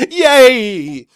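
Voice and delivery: high loud yay; loud cheer. Real, not acted. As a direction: cheer before reading it. Cheer before reading.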